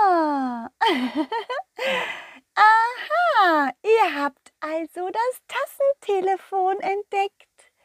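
high giggly fairy voice. Acted. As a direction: speak as a playful little fairy. Garden fairy speaks.

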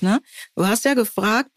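ordinary talk

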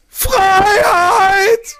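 high, loud rallying shout; battle cry. Acted. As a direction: yell it like leading an army charge. Host performs Braveheart battle cry.

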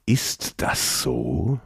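slow, whispered ominous question; ominous creature. Acted. Creature darkly doubting the prince, slow whisper. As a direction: let it drip with cold menace.

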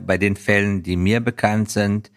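usual speaking voice

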